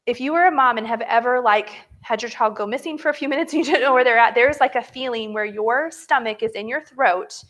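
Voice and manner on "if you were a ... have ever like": normal talking voice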